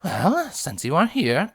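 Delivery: ordinary delivery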